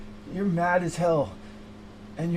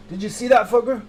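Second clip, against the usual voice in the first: normal talking voice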